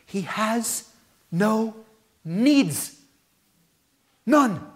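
emphatic preaching. Acted.

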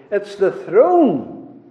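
"throne" drawn out, loud and weighty; slow down and lean hard on "throne"; stress on throne. Shown as a demonstration. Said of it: "I first want to emphasize the word throne".

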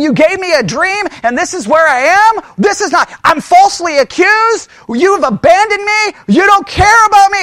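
acted angry grievance, rising in pitch; upset complaining. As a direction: hurl accusations in mounting indignation. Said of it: "I'd be grumbling, complaining, upset".

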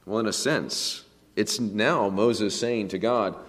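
ordinary talk, nothing marked

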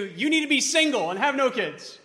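a nagging voice that commands you; worldly demand, yelled. Retold or imitated. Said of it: "Or the world may be screaming at you".

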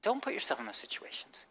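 ordinary talk, nothing marked

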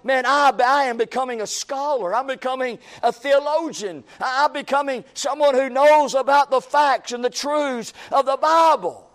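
hammed-up pride in knowing facts; mock proud scholar. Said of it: "you'll find yourself".

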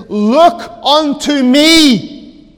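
slow, loud, high call to sinners; pulpit cry. Retold or imitated. Voicing preacher's cry as Christ.

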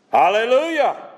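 loud, high shout of praise; crying out. Acted. Preacher showing what the multitude sounds like, crying out. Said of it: "And they're crying out".

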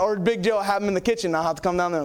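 usual speaking voice

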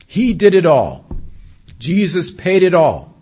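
loud, high preacher's climax; exultant proclamation. Real, not acted. Preacher proclaiming Christ's finished work to the congregation, exultant. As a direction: proclaim each short phrase at full voice.

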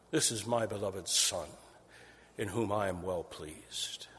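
soft, falling to a whisper at the end; hushed voice of God. Acted. Preacher voices God at Jesus' baptism, hushed to a whisper. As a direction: breathe God's words in awe. God's voice from heaven quoted.

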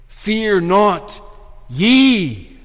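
"Fear not", pause, then a loud, held "ye"; stress on YE. Acted. Angel singling out the believing women, stress on "ye". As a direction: set "ye" apart and lean on it. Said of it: "all the emphasis is on that word ye".

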